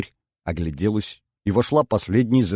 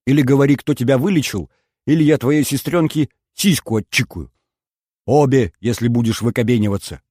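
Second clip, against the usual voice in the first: a hint of a menacing thug, louder; mock thug threat; 5 semitones higher, 6 dB louder than usual. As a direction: play the kidnapper shouting the threat. Rita voices a threatening kidnapper. (Рита изображает бандита: угроза с криком)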